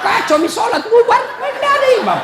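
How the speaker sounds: very high, loud comic outcry; seruan panik. Retold or imitated. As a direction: cry out as if the whole prayer collapsed. Retold chaos as congregation breaks up. (teriakan heboh bernada sangat tinggi)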